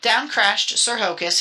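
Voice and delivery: usual speaking voice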